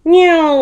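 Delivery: high, loud falling plane whine; toy airplane noise. A narrator's reading. Baby Bear playing airplanes in bed, whining engine noise. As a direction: swoop down like a child's airplane. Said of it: "pretending to be an airplane".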